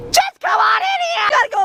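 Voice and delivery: shouting urgently from the house. Acted. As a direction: yell for them to come in. Calling friend into house.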